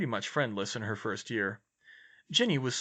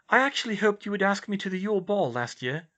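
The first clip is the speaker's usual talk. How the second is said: higher-pitched, flustered confession; flustered heroine voice, pitched up; blurted out; 9 semitones higher, 4 dB louder than usual. A narrator's reading. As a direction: blurt it out, embarrassed. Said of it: "she blurted".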